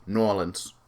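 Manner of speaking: "New Orleans" shortened to NAW-linz; local pronunciation. Shown as a demonstration. Pronouncing other places as they do, NAW-linz. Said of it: "so I pronounce it".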